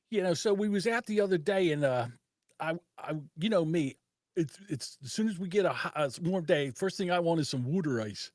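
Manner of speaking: put-on South Philly accent. Retelling a woman's video about water ice, South Philly accent. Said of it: "She's either South Philly".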